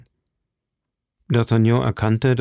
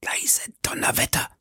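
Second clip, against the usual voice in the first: whispered oath under the breath; hushed whisper; 7 dB quieter than usual. A narrator's reading. Athos swearing in a stunned whisper to his friends. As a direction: breathe the curse out, voiceless.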